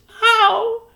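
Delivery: very high loud alarmed cry; a tiny mother aghast; Homily's voice. A narrator's reading. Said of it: "cried Homily".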